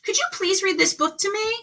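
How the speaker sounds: high, quick hopeful request; lightly voiced eager Duncan. A narrator's reading. Duncan eagerly asking the possum to read. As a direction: ask sweetly and quickly, hoping for a yes. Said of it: "he said to the possum".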